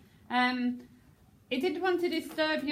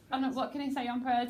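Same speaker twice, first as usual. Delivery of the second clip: normal talking voice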